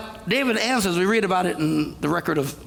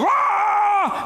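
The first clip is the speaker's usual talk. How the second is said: loud, high war cry; short hammed-up battle shout; mock battle yell; 13 semitones higher, 3 dB louder than usual. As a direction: roar it like an army taunting. Armies yelling across the valley.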